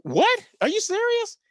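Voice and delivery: can't believe the call; stunned disbelief. Retold or imitated. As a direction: act floored by the news. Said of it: "I'm like".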